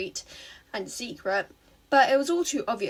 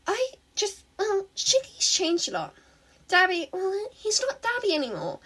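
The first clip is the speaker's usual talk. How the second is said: put-on high, halting villain girl voice with a catch; a lonely villain opening up; the villain telling her rival her friends are gone; sad villain girl; 6 semitones higher than usual. A narrator's reading. Himiko confesses her troubles.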